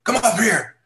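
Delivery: a high, loud call from a distance; retold yell. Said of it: "And he just yells at me".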